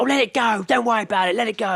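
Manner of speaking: high put-on soothing voice; calm him down, high and dismissive; imitating a woman soothing her partner; soothing woman quote. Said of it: "She's like".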